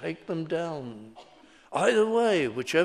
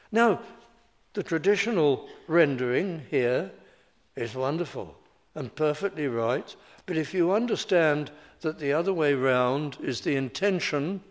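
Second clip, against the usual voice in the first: ordinary talk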